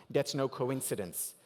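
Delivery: usual speaking voice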